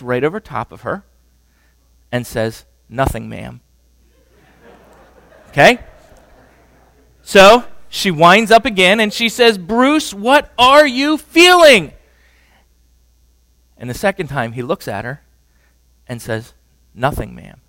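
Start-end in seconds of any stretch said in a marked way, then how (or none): 2.9-3.6 lower, emotionless military answer; deadpan soldier reply. Retold or imitated. 9.6-11.9 much higher and louder, slowed demand; demanding yell. Retold or imitated. Wife winds up and demands again. 16.9-17.6 lower, emotionless military answer; deadpan soldier reply. Retold or imitated. Husband answers flatly again.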